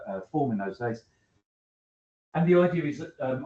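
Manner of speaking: ordinary delivery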